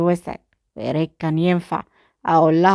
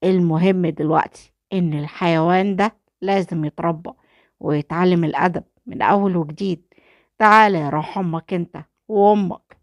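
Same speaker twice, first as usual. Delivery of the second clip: usual speaking voice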